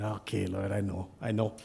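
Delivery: low, soft mock-reluctant surrender; resigned giving-in. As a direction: drop your voice, conceding under pressure. Gives in to God's prompting.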